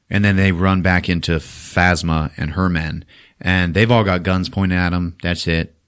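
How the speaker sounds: ordinary talk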